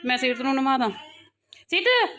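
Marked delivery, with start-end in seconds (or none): 1.7-2.2 high, short call of the name. Real, not acted. Mother calling child for bath, calling out.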